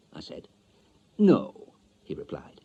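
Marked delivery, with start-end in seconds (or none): 1.2-1.7 higher, louder officious salesman voice; pompous bureau man. Acted. Marriage bureau man telling Mister Stanley, officious and loud. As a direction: lay on the formal jargon.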